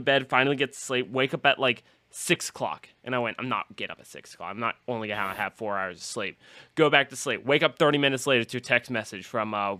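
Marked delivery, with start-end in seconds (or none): 3.4-6.4 groggy mumble. Retold or imitated. Retold sleepy grumble.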